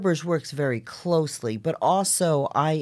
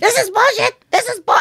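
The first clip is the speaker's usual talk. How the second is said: high mock yell at the play; a professor yelling in protest; an audience member shouting in outrage; retold yell; 4 dB louder than usual. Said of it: "he's yelling".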